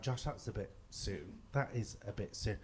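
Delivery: mock-cautious, low and soft; quiet aside. Friends doubting a quick move-in, quiet aside. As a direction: voice disapproving friends under your breath.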